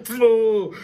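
normal talking voice

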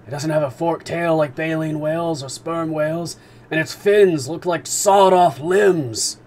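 a sailor yelling as the beast dives; excited harpooner shout. Acted. Ned sees animal dive.

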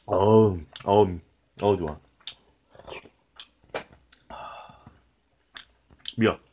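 talking while chewing; eating hot soup; mouth full chewing. Real, not acted. Seen 0: took a spoonful at -4; mouth moving, chewing through labelled words.

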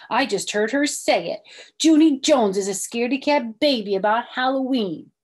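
loud tattling shout to the class. Acted. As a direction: announce it loudly to the whole room. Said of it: "she shouted".

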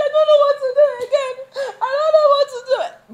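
very high, loud helpless whine; hammed-up crying voice, rushed at first; mock tearful wail. Retells own tearful helplessness.

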